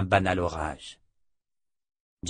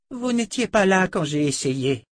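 a higher voice for the sorceress; slightly higher, answering the reproach; lightly voiced defensive sorceress; 10 semitones higher, 5 dB louder than usual. A narrator's reading. Sorceress answering the men's reproach, lightly defensive.

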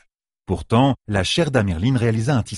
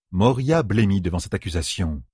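plain reading voice

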